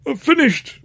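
high, strained splutter; loud squeaky protest, half coughing; coughed reply. A narrator's reading. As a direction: choke it out through a mouthful. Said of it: "I coughed".

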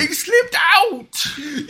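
very high, drawn-out squeaky apology; slow high whine. Pretend. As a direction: stretch it out like a whimpering dog. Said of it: "horrible, horrible man".

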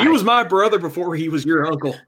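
a touch of a wounded tough-love father; dad voice. Pretend. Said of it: "in your daddest voice".

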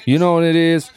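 high, loud, insistent mimicry; mock impassioned speaker. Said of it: "and a man says".

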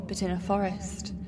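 normal talking voice

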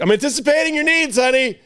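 high sing-song yell across the room. Retold or imitated. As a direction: call it out across the house. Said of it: "Like, just yelling".

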